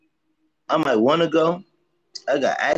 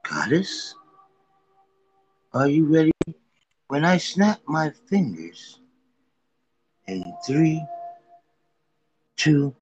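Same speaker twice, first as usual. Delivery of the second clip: slow hypnotist voice; 3 semitones higher, slower than usual. Pretend. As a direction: lull her into a trance slowly. Mock hypnosis of partner.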